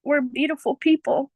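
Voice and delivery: usual speaking voice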